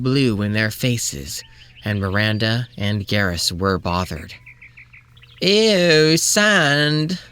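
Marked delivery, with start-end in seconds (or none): 5.4-7.2 higher-pitched, drawn-out whine of disgust; complaining moan. A narrator's reading. Miranda gripes about sand in her face, moaning. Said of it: "moaned Miranda with complaining".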